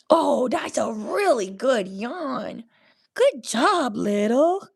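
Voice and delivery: high, swooping praise for a pet; doting praise. Real, not acted.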